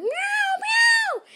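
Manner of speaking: very high, loud meow; cat meowing. Acted. Storyteller imitates the cat, high loud meows. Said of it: "The cat started meowing".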